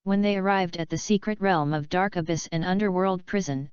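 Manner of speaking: usual speaking voice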